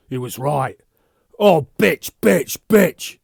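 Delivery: very loud high screamed insults; loud furious screaming curse; screaming rage. Acted. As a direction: scream at her in fury. Furious quarrel.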